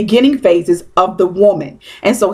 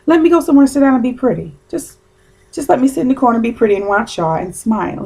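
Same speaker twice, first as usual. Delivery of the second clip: dainty lady; 3 semitones higher, faster than usual. Retold or imitated. Voicing her retired self.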